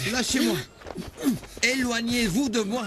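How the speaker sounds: struggling shout. Acted. Man struggling against the guards.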